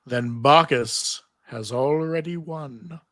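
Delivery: slow, heavy voice of Orpheus's severed head; slow ominous head. Acted. Narrator voicing Orpheus to Xena, slow and ominous. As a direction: drag each word out like a scheming head.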